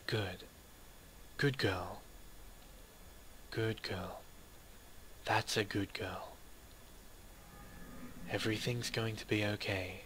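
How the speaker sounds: put-on calm a crying child down gently; soft soothing murmur; soothing hush. Girl soothing the crying narrator.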